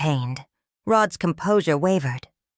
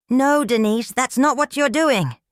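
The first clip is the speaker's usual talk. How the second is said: higher and louder, full of conviction; trembling conviction; 7 semitones higher, 4 dB louder than usual. A narrator's reading. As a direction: stand up to her, heart pounding. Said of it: "her voice trembling slightly but full of conviction".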